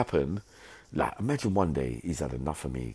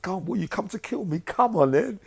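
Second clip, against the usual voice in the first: high, loud come-on; fight challenge; 4 dB louder than usual. Retold or imitated. Yummy taunting three attackers, high loud retold challenge. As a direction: taunt them into making a move. Challenging men who came to kill.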